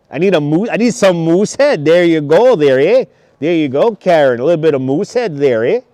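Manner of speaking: hearty put-on Canadian accent. Livestreamer offering Karen some Moosehead, in a mock Canadian accent. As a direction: lay on a Canadian accent, lilting up into "eh". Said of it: "It's a great Canadian accent".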